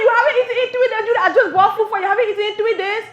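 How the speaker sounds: loud, high-pitched outraged disbelief; incredulous mother. Retold or imitated. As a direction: fire the question back at a lying child, loudly. Mother incredulous at son's lie.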